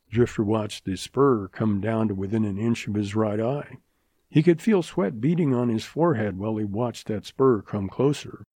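ordinary delivery, nothing marked

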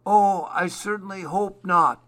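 high, put-on owl voice; owl character. A narrator's reading. Owl objects from his tree.

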